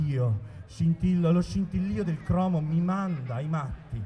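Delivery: mock-romantic poem reading; poem recitation. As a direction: recite like a tender love poem. Recites a comic love poem. (recitazione poetica ironica)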